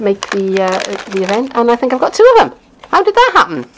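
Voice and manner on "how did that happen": much higher, puzzled how did that happen; a bemused high-pitched question; puzzled surprise. Real, not acted. Finding two of the same swap in the pile, bemused and high.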